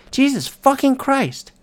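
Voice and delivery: high, loud, fed-up exclamation; exasperated curse. Real, not acted. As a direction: blurt it out in disgust. Exasperated about the game.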